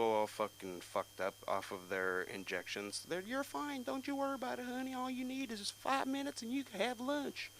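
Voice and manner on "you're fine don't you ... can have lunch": high, soft mocking falsetto; sugary put-on high voice; reassuring dental staffer. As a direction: coddle the patient with breezy assurances. Dental staffer dismisses his worry.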